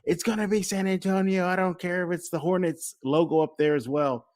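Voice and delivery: high-pitched retold fan's outburst; imitated Rockets fan. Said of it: "This one guy".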